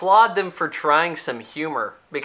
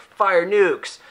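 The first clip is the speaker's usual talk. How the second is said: a barked order, louder and higher; mock command; 5 semitones higher, 4 dB louder than usual. Retelling the president ordering the launch, barked order. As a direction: snap out the launch order like a cartoon villain.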